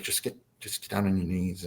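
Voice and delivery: low, hushed guidance; very soft imitation of a calming handler; tall gray coaxing. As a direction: coax under the breath. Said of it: "And the tall gray, like".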